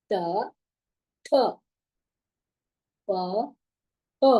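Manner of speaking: த த ப ப, second one hard with extra breath; each pair plain then breathy; aspiration demo. Shown as a demonstration. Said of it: "See the pronunciation".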